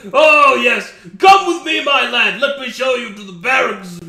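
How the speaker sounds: loud, high, jolly officer's voice; boisterous hearty welcome. Acted. Mon Mon welcoming the new recruit, loud and jolly. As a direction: boom it out like a cheerful drill master.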